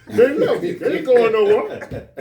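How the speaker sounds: high, loud, laughing through it; wound-up preacher rant, half laughing; mock rant. Old teacher ranting about women, high loud and laughing.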